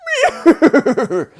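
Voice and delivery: a sudden high burst of laughter. Real, not acted. Vlogger laughs at his own puppy impression. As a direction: laugh at your own joke.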